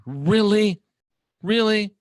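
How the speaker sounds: high, disbelieving "Really?" asked twice; can you believe these people; incredulous. Real, not acted. Scolding his own truth-movement audience, high incredulous "Really?".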